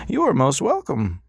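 patient, polite, in no hurry; old doctor. Acted. Old doctor to silent Ras, high and courteous.

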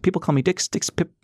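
bright, high, self-satisfied; perky young woman. A narrator's reading. Dixie introduces herself.

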